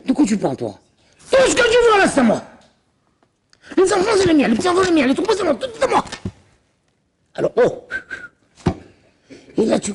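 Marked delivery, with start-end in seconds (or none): 1.3-6.0 grab everything as yours; high boastful father-in-law; greedy Laban. Acted.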